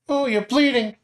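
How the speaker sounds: high-pitched Nami, hushed and curious; high girl voice. Acted. Nami asks her friend about a rustling noise, high girl voice.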